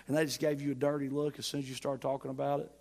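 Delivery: normal talking voice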